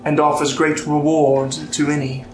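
measured storytelling with lifted phrase openings and long pauses; solemn legend narration. Acted. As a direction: read it out as an old myth, phrase by phrase. Game master reads a legend aloud.

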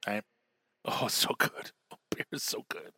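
soft, breathy pleasure after drinking; whispered relish. Real, not acted. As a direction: whisper it, savoring the beer. Savoring beer.

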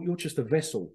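normal talking voice

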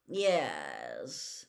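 low, sullen one-word answer; resentful. A narrator's reading. Malfoy answers Moody's question about Snape, resentful. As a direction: give it up grudgingly, pitched low. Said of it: "said Malfoy resentfully".

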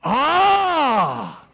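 long, high, loud ah; realization cry. Acted. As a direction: exclaim as it dawns. Preacher's dawning realization.

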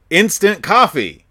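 high, loud and slow; grand announcement. Acted. Announcing the joke spell name.

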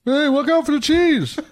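high, laughing mock British accent; a hammy British town crier voice, laughing through it. Host playing the town crier in a laughing British accent. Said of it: "That's my British accent".

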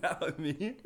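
high, loud, talking through laughter; real laughing. Real, not acted. Co-host helplessly laughing while arguing about the reindeer, laughing. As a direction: crack up while trying to get the words out. Cracking up over Rudolph argument.

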